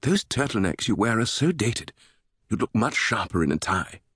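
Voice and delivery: teasing British lilt; sing-song British fashion advice; amused British woman. A narrator's reading. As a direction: tease his clothes in a British voice. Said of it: "The woman laughed".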